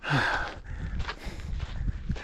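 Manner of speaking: long weary exhale; exasperated sigh. Real, not acted. Route goes up again.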